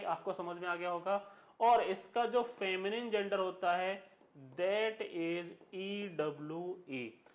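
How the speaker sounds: ordinary delivery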